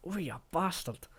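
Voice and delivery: high-pitched mock yelp of pain; acted pained cursing. Showing what you say when you've hurt yourself, acted pained curse. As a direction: swear through a pretend stubbed toe. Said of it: "if you've hurt yourself".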